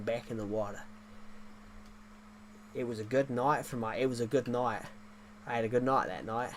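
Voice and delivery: broad mock-Aussie storyteller; Australian accent. Streamer tells a shark-wrestling yarn, mock Australian accent. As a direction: spin the yarn in a put-on Australian twang. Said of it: "I can do an Australian accent".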